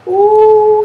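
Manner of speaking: sudden high, loud "Ouh" at the card; a real gasp of surprise at a card; surprised ouh. Real, not acted. Card reveal while opening a pack.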